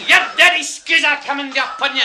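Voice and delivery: high, loud Scottish threat; shouted Scottish abuse; wild Scotsman. Retold or imitated. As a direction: yell it as a raging Scottish prisoner. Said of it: "this Scotsman goes wild".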